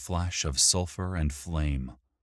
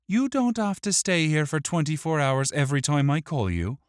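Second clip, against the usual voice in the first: high-pitched, astonished protest; indignant Wick; 11 semitones higher, 4 dB louder, faster than usual. Acted. Wick catches out the imp, astonished and indignant. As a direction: blurt it in rising disbelief.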